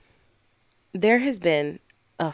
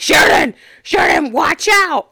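loud, high imitated yell; shouted warning; 8 semitones higher, 11 dB louder than usual. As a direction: holler an urgent warning, acted out. Coworkers' warning acted out.

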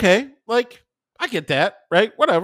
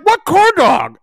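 put-on confused old man; 9 dB louder, slower than usual. Old dad voiced confused.

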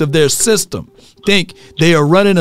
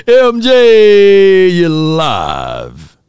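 drawn-out call-out; 3 dB louder, much slower than usual. Real, not acted. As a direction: stretch it out like a show shout. Host calls out his catchphrase.

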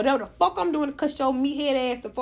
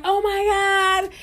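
higher, slow worried mother; imitating worried mama; mama quoted; 7 semitones higher, much slower than usual. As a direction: slowly imitate mama's fretting. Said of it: "my mama called me".

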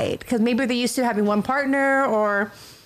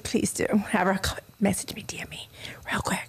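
soft whisper; breathy hushed aside; 6 semitones lower, 6 dB quieter than usual. Real, not acted.

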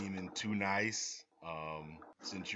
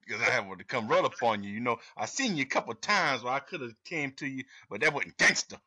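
louder, higher imitated confrontation; loud mock retort; 3 semitones higher, 8 dB louder, faster than usual. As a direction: act out a raised-voice comeback. Acts out [name]'s retort to host.

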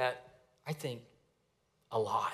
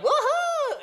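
high whooping yell with a laugh; wild laughing whoop; gleeful scream; 7 dB louder than usual. Retold or imitated. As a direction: whoop it up like a prankster bursting into a dark cabin. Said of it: "start screaming".

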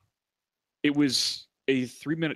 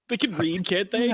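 sarcastic; 10 semitones higher, 5 dB louder than usual. Real, not acted. Sarcastic retort to cohost.

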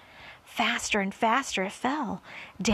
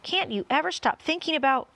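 higher-pitched irritated scolding; exasperated earthworm; 7 semitones higher, 4 dB louder than usual. A narrator's reading. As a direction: scold a tiresome companion. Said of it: "said the earthworm".

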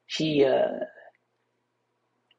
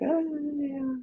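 embarrassed; 12 semitones higher, 5 dB quieter than usual. Retold or imitated. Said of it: "all embarrassed".

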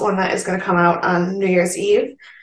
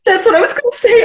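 laughing; 5 dB louder, faster than usual. Real, not acted. Laughing agreement.

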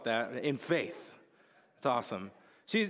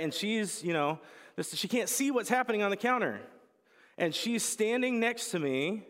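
ordinary delivery

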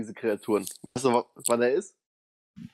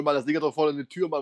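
ordinary talk, nothing marked

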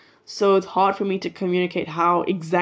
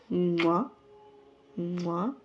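blown kiss; 7 dB quieter than usual. Real, not acted. Blowing kisses to listeners.

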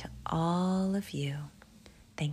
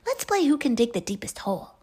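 put-on high, fast and loud child; eager invitation; 7 semitones higher, 7 dB louder, much faster than usual. Albie pitching a game to meerkats, quick high voice. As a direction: rattle off the game idea keenly.

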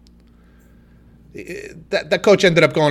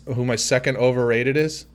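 normal talking voice

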